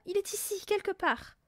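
high-pitched certainty; earnest insistence. A narrator's reading. Mathilda backing up her mother, high insistence. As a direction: back the claim up eagerly. Girl insists intruder is present.

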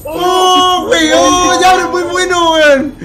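lamento exaltado. Real, not acted. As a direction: cry out over how close the jackpot was. Bonus ends, lamenting and marvelling. (lamento a viva voz, exaltado)

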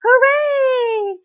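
high, loud hooray; long celebratory shout; crowd cheer. A narrator's reading.

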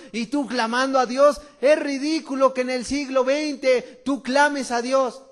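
loud, scoffing ridicule; mocking scorn. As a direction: sneer at the believer. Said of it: "tratan de ridiculizarlo".

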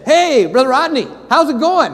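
high, bright mock-happy greeting; cheery greeting. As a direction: imitate the always-happy pastor. Pastor's cheery greeting retold.

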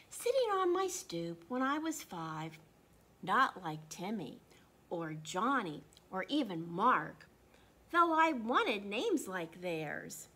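put-on reading a picture book to children; story reading. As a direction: tell it gently, like story time.

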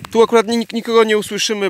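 usual speaking voice

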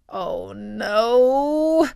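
drawn-out rising dread; dismayed Jack. A narrator's reading. Jack hears his boast repeated, dismayed. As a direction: let the dread swell through it. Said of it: "thought Jack".

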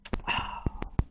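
unvoiced heavy exhale; real out-of-breath sigh; breathless sigh. Real, not acted. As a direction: let out a winded breath. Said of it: "why was I out of breath".

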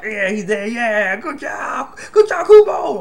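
sarcastic cheer, rising to a loud shout on Kubo; mockingly applaud the author, building to a yell; mock cheering. Reviewer mocking fans who cheer a death, sarcastic shout. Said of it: "I'm not gonna sit here and be like".

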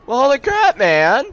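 loud, very high-pitched yell; startled outcry. Real, not acted. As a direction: yelp it out in alarm. Falls through floors in game.

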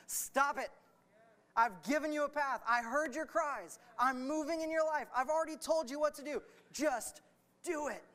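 high, loud, emphatic scolding; exasperated command. Acted. God answering endless cries, as acted by preacher, exasperated. Acts God fed up with crying.

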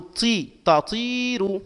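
very slowly stretch "طي" in "تطير"; drawn-out long vowel. Shown as a demonstration. Teacher analysing the word for students, drawn-out long vowel. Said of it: "مد مع الممدود".

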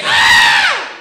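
high, very loud mock shriek; full-blast goat-like scream; baby goat scream. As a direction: imitate a scared brother screaming like a baby goat. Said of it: "He screamed like a little baby goat".

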